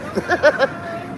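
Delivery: high unvoiced laugh; breathy laugh. Real, not acted. Laughs after "That's stupid.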